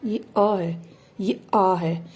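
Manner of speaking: अ said short, आ drawn out; teacher showing अ versus आ; short and long a. Shown as a demonstration. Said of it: "इन दोनों में फ़र्क है".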